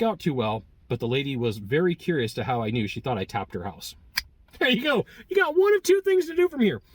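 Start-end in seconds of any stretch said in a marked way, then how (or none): 4.6-6.8 much higher, louder, pumped; excited outro. Real, not acted. Vlogger hyping viewers to subscribe, excited. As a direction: sell the subscribe ask with a big upbeat push.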